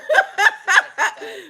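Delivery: high giddy cackle; high laugh. Real, not acted. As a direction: laugh high and bright with delight. Delighted by the leader.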